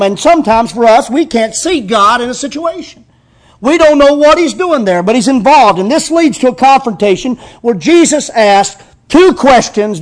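high, loud preaching build; preaching at full force. Acted. Pastor building to the congregation, loud and high. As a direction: preach it with rising fire.

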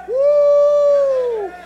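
long loud high whoop; joyful woo. Acted. Preacher reliving his salvation, long joyful whoop. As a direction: whoop for joy. Shouting church joy.

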